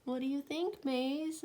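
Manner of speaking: plain reading voice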